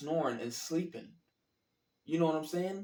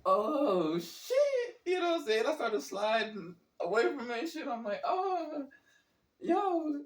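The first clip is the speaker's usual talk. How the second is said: high, panicky alarm, voice jumping up; panicked alarm; 12 semitones higher than usual. Retold or imitated. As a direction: squeal in alarm as you back away. Panicked sliding away.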